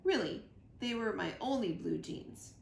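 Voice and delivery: ordinary delivery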